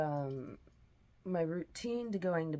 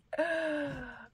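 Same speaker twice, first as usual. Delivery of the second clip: high, loud falling sigh; 9 semitones higher, 4 dB louder than usual. Real, not acted. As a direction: let out a big loud sigh. Reacts after the bright light.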